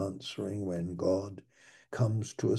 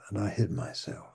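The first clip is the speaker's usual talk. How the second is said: ordinary talk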